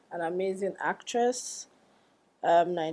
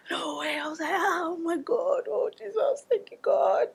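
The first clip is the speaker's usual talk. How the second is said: high-pitched, breathless mock scream of joy; retold excited screaming; 10 semitones higher than usual. Friend reliving her reaction to the Vogue news, excited screaming. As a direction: squeal in thrilled disbelief, drawing it out. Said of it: "I was screaming. I'm a screamer".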